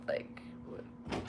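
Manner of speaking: breathy disbelieving whisper; incredulous whisper. Real, not acted. To the listeners about the impossible schedule, whispering. Exasperated aside after impossible math.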